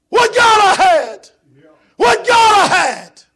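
high, loud, slow lament; echoed lament. Acted. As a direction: cry out the regret. Preacher echoing the lament.

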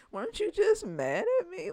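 high, loud disbelieving question; mock the ex, pitch way up; incredulous. Real, not acted. Calling out a flip-flopping ex, high and incredulous.

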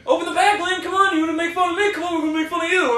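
high, rushed mock yell; imitating Brett yelling. Friend imitates Brett yelling at Lane, high and rushed. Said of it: "this motherfucker is yelling".

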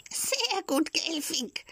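very high, thin little screech; a squeaky voice teasing from the wood; the log teasing Geppetto in a tiny shrill squeak. Acted. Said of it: "kreischte ein feines Stimmchen".

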